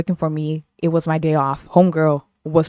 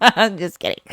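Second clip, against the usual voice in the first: laughing. Real, not acted. Laughs off her joke.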